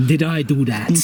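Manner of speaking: mock-Russian Did I do that, low and heavy; Russian accent. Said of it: "can you say that with a Russian accent".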